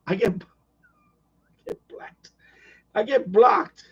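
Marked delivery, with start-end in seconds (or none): 0.3-2.3 whisper. Shown as a demonstration. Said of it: "whispered repeat".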